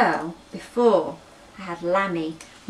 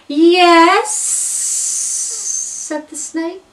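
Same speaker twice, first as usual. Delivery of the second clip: high, loud yes, then a very slow hiss; slow hissing snake voice; drawling snake; 10 semitones higher, 10 dB louder, much slower than usual. Acted. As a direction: snake waking lazily. Sleepy snake answers.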